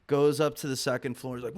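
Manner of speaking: usual speaking voice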